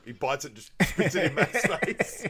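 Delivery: ordinary talk, nothing marked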